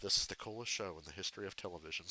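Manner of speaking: a hint of Butthead mumbling it fast; Butthead impression. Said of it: "Butthead goes".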